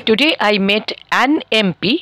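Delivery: slow, careful an M P; slow model sentence. Shown as a demonstration. Teacher contrasting short-form example with students. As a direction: say an M P slowly and clearly. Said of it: "তাহলে".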